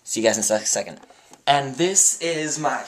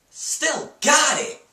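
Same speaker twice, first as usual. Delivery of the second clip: high, loud and slow triumph; excited; 7 dB louder, much slower than usual. Real, not acted. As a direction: crow it out after a wobble. Recovering from wobbly pirouette.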